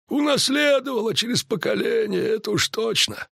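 high-pitched druid voice. A narrator's reading. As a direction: state it knowingly in a high voice. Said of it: "проговорил друид". (друид, высоким голосом)

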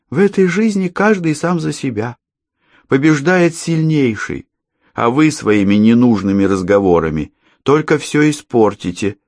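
put-on high, cold child's voice; sinister little girl; creepy girl.

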